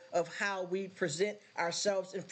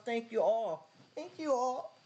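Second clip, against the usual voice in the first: real tearful thanks, pitched up; overwhelmed, fighting for composure; trying not to cry; 4 semitones higher than usual. Real, not acted. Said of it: "Let me get myself together".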